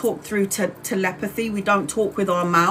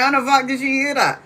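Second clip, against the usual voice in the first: fast, higher, laughing in stunned disbelief; 6 semitones higher, faster than usual. Retold or imitated. To her audience, amazed her mum heard her thoughts, laughing disbelief. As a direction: giggle it out, still amazed.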